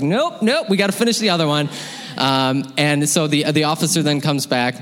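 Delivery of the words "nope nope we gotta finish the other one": high, fast no-nonsense mom; brisk, insistent mom; firm mom. Retold or imitated. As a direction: mom holds kid's leg to finish. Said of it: "My mom grabs my leg and is like".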